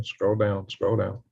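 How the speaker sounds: ordinary delivery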